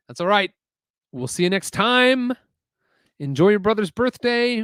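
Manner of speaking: high bright goodbye; cheerful reassuring. Real, not acted. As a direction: reassure him kindly.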